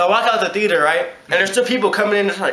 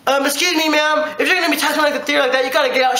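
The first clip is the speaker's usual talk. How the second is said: prim manager; 6 semitones higher than usual. Retold or imitated. Offended manager scolds the customer.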